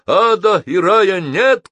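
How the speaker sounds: high, loud, emphatic denial; shout. A narrator's reading. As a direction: shout it back in defiance. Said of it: "крикнул Геннадий".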